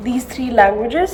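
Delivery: ordinary talk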